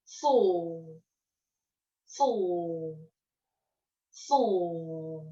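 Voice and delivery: slow, careful "faux" for the class to copy; teacher modelling "faux" for repetition; model word. Shown as a demonstration. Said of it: "Je vous écoute".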